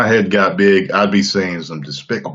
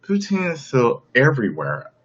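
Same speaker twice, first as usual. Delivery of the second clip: lightly mimicked woman's voice, higher; put-on higher female voice; imitating her talk; 4 semitones higher, 3 dB quieter than usual. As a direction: mimic her with the pitch lifted. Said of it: "That's how she talks".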